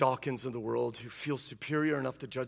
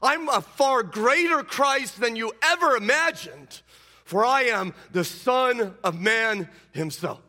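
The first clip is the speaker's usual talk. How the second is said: loud, high, slow proclamation; proclaiming loudly; 9 semitones higher, 7 dB louder, slower than usual. Acted. Preacher voicing Jesus, loud and slow. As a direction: ring it out as Jesus declaring.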